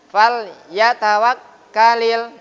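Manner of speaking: Fal yatawakkalil slow and relaxed, no pressure on the lam; relaxed idhar. Shown as a demonstration. Teacher showing the correct relaxed recitation. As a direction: let the lam of Fal come out clear, slow, unstressed.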